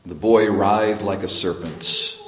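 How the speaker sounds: ordinary talk, nothing marked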